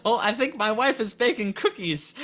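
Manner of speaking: high-pitched grandpa quote through laughter. Retold or imitated. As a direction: imitate a grandpa while cracking up. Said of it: "because he's like".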